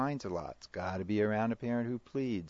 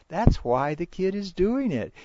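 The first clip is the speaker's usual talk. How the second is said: ordinary talk, nothing marked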